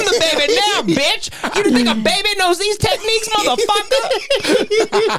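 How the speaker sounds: very high-pitched indignant imitation; mock angry taunt. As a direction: taunt like an irate man, drawing out the end. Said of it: "He goes".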